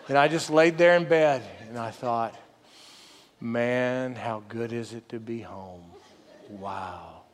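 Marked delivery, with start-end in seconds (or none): 3.4-7.2 low, soft, savoring murmur; retold private wonder, sinking quieter; quiet relief. Dad back in his own bed after camping, quiet relief.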